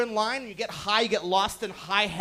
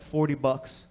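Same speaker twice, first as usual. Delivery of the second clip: much lower, flat voice; deadpan the price; flat low dealer; 8 semitones lower, slower than usual. Retold or imitated. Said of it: "He's like".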